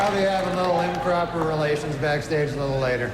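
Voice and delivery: loud, brash stand-up host working the crowd; awards host monologue. Acted. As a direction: play to the room like an awards show host. Stand-up host monologue, louder, announcer.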